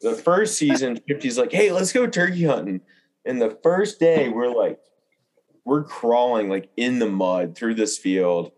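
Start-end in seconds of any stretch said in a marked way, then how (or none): 1.5-2.8 high, upbeat invite; lightly voiced eager friend; mock-cheerful invite; retold invitation.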